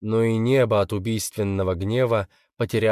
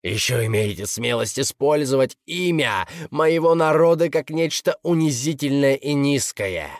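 raised, raging demon voice; furious demon; 6 semitones higher, 3 dB louder than usual. Acted. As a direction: roar it at the priests. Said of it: "Демон был в ярости".

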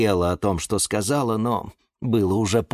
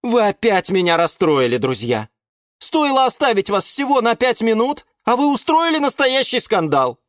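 high, exasperated reproach; high-pitched aggrieved chiding; upset scolding; 14 semitones higher, 4 dB louder than usual. A narrator's reading. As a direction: scold naughty friends. Owner scolds his quarrelling plants.

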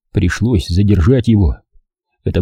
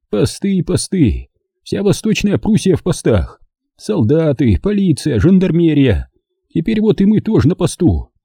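put-on higher, worn old man's voice; 8 semitones higher than usual. A narrator's reading. Captured old man explains himself to partisans, higher old voice. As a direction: play the frightened old German.

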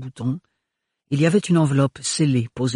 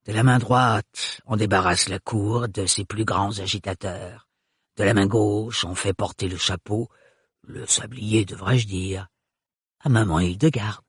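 put-on sly old woman; 3 semitones lower than usual. A narrator's reading. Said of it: "agiter comme des marionnettes".

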